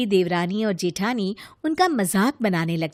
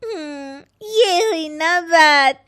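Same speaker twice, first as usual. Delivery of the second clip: smug delight; 5 semitones higher, 3 dB louder, much slower than usual. Acted. Rita wins, pleased.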